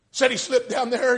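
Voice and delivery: high, loud preached line; preaching refrain. Acted. Preacher driving the story home, refrain.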